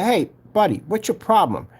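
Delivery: high-pitched retold challenge; mock confrontational question; confronting. Acts out confronting the man.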